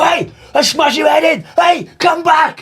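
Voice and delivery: very high mock shout after a passing van; hammed-up hard man hollering at a van to come back; mock yelled threat.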